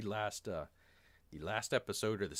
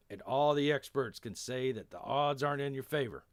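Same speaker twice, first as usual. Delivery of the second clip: put-on emphatic Texan accent, raised and louder; 4 semitones higher, 4 dB louder than usual. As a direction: give a rousing speech as a Texan. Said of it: "a weird Texan accent".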